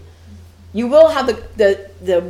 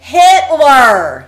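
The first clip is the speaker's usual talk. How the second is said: loud punchline; 6 semitones higher, 11 dB louder than usual. Acted. Punchline on the scale.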